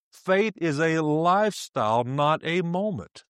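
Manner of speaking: deliberate, stretched-out maxim; slow emphatic repeat. Real, not acted. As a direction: drive it home slowly, drawing out "lifestyle".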